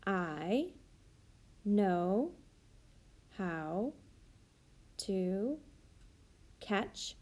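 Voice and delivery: each word said alone with long gaps while writing; slow word-by-word dictation. Shown as a demonstration. Teacher modeling writing to class, slow word-by-word dictation. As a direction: sound it out slowly, one word at a time as you write it. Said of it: "I can start with".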